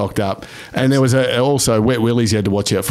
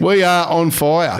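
mock triumphant; 6 semitones higher, much slower than usual. Mock-grand repeat of earlier line.

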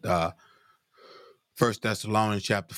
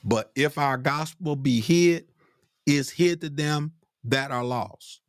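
slow, higher, weighty reading of the verse; emphatic scripture reading; 6 semitones higher, slower than usual. Acted. Preacher reads Corinthians to congregation, slow and emphatic. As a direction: lean on each word as you read.

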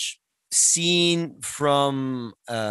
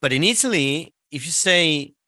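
usual speaking voice

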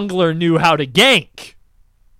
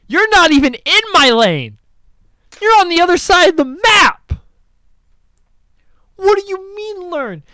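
high, loud incredulous retort; retold outrage; 11 semitones higher, 5 dB louder than usual. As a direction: fire back at the player in disbelief. Arguing with player in chat.

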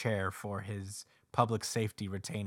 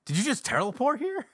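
much higher, louder startled squeak; shocked; 11 semitones higher, 7 dB louder than usual. Retold or imitated. Sword Guy finding Denji already on the stairs, startled. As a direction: jump back in disbelief.

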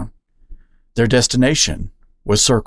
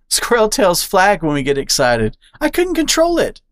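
put-on higher, bubbly squirrel girl; excitable squirrel; 9 semitones higher than usual. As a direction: chatter on with eager energy. Squirrel Charlie explaining.

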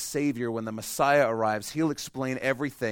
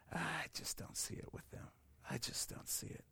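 dismissive whispered aside; doubtful whisper; 4 semitones higher, 19 dB quieter than usual. Retold or imitated. A churchgoer doubting someone could believe, whispering. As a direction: whisper it like a sceptic writing someone off.

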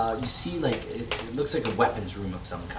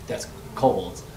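ordinary talk

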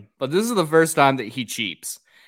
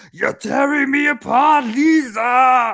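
much higher, loud hammed-up shout; worked-up Tommy shout; 13 semitones higher, 9 dB louder, slower than usual. Host acting out Tommy's big scene, full shout. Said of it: "he's so worked up, and he's like".

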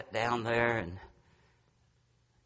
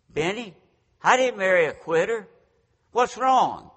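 higher, louder mock wifely rebuke; imitated wife; 8 semitones higher, 6 dB louder than usual. Wife to the husband who quit, imitated rebuke. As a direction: confront your husband in a raised voice.

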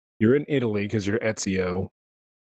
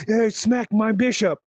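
mock game character cheer; 11 semitones higher, slower than usual. Background guy quoted in game.

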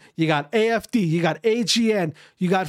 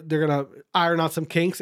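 ordinary talk, nothing marked

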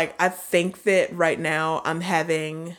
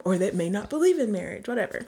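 ordinary talk, nothing marked

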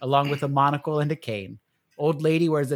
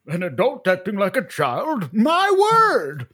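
rising to a high, loud squawk of shock; scandalized old gentleman; 6 semitones higher, 6 dB louder, slower than usual. Acted. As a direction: clutch your pearls and shriek.

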